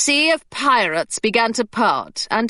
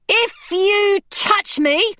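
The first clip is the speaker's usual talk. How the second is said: much higher, slow fierce warning; Hilary threatening; 9 semitones higher, much slower than usual. A narrator's reading. Hilary threatening the pirate, high and slow. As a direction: threaten him through her teeth.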